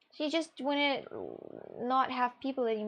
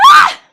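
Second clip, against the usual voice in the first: sudden piercing yelp; high scream; 26 dB louder than usual. Real, not acted. Sudden outburst amid smoothie story.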